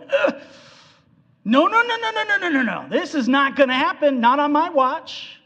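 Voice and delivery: panicked refusal, cranked up high; Peter's rebuke. Retold or imitated. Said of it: "So Peter going".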